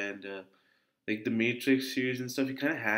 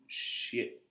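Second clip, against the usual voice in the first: normal talking voice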